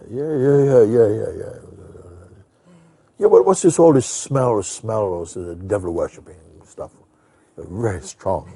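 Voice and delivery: lightly voiced elder, doubtful and pushing back; elderly Jamaican man. Quoting the suspicious elderly man.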